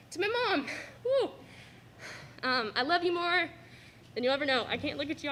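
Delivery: ordinary talk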